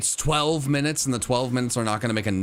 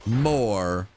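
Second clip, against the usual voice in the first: at wits end; 2 semitones higher, much slower than usual. Acted. Exasperated plea to the game.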